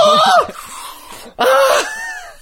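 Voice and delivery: shrieking high laugh; big high laugh. Real, not acted. Laughing at his own bit.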